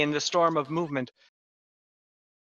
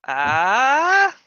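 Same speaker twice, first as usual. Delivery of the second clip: confused crowd "Ah?"; 7 semitones higher, 6 dB louder than usual. Acted. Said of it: "The people go".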